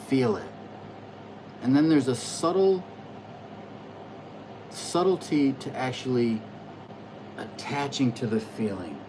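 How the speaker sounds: usual speaking voice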